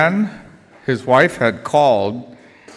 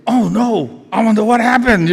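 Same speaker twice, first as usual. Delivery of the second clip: higher, worried exclamation; retold alarmed husband; 7 semitones higher, 3 dB louder than usual. Husband hears his wife is phoning, alarmed. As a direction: imitate sudden concern at bad news.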